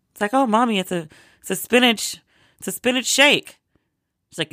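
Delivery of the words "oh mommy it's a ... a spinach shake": high-pitched, coaxing retold daughter; daughter explaining. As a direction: pitch it up, explaining to Mommy haltingly. Daughter placating her disgusted mother.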